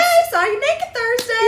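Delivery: high, drawn-out awkward hello; mock party greeting. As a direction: sing out a comically awkward greeting.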